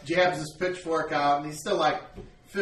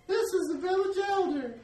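put-on high old mother voice. Mother introduces elder.

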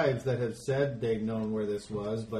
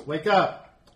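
sharp loud shout; shouted command; 6 semitones higher, 10 dB louder than usual. Acted. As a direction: bark him awake. GM voices Talasek slapping Baron.

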